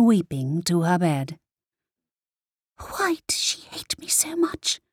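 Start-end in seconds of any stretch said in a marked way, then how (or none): 2.8-4.8 put-on high, breathless child's whisper. A narrator's reading. Girl asking her father in bed, whispering. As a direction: whisper it like a hurt little girl. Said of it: "whispered Kaina".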